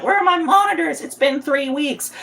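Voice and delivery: high, squeaky mock diva whine; whiny diva. A customer mocking himself as a diva demanding his monitors, high squeaky whine. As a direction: mimic a spoiled diva demanding her order. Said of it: "I'm not trying to be a little diva being like".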